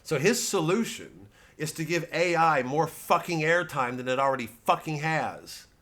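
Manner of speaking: usual speaking voice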